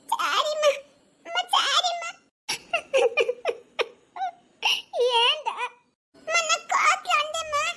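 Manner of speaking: put-on tearful child. Child apologising to mother.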